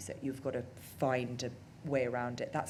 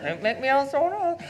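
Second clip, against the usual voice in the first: higher and louder, playfully goading; sing-song teasing jab; teasing taunt; 11 semitones higher, 11 dB louder than usual. Retold or imitated. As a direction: needle him with a mock threat. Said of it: "taunting him every day".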